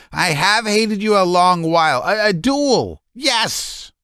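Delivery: high, strained, halting; stammering hatred. A narrator's reading. Laevsky hateful.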